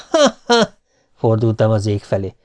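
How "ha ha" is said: mock laugh. A narrator's reading. Husband mocks her.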